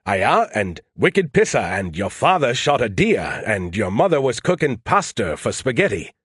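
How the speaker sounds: hammed-up Maine dialect, each phrase held up for ridicule; mock Maine accent. Skippy mimics Joe visiting his parents, thick mock Maine accent. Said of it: "you were all like".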